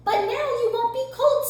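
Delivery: high cheerful bird voice; small bird speaking high. A narrator's reading. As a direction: reassure Rabbit, high and bright. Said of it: "said Bird".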